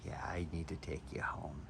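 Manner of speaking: quiet, low murmur of surrender; soft giving-in reply. Retold or imitated. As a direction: give in to the knife under your breath. Answering the tempting knife.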